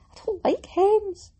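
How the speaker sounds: high, loud burst of complaint; venting. Real, not acted. Podcaster to listeners after finishing a dress hem, venting. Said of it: "I'm still venting".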